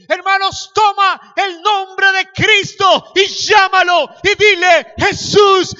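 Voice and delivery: high, shouted exhortation with stretched words; full-force preaching. Real, not acted. Preacher urges church to call on Jesus in trouble, full-force preaching. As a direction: build to a drawn-out shouted climax.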